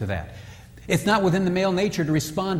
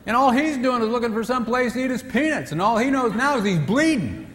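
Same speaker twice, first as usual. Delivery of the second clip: ordinary talk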